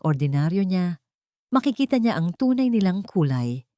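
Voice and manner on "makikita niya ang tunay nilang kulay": ordinary delivery, nothing marked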